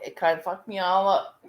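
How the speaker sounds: ordinary talk, nothing marked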